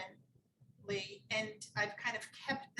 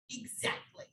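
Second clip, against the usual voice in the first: usual speaking voice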